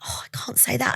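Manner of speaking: timid imitation. Mentor imitating women afraid to speak up, timid whisper. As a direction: shrink back into a mock whisper.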